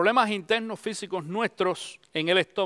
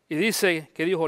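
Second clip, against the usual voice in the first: ordinary delivery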